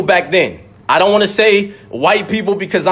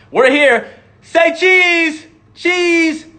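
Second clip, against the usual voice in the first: higher, sing-song say cheese; mock photo pose; 8 semitones higher, much slower than usual. Mocking the lynching crowd posing for a photo, high sing-song. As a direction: chirp it like posing for a snapshot.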